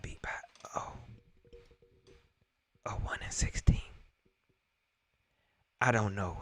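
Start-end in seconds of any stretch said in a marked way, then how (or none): 0.0-3.8 whisper. Real, not acted. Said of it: "whispered in disbelief".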